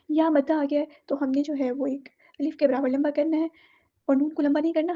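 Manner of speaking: usual speaking voice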